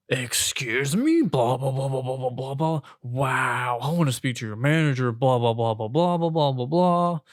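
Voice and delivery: loud, droning blah-blah rant; mock yelling customer. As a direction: imitate an irate customer ranting nonsense. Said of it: "He was just, like, yelling at me".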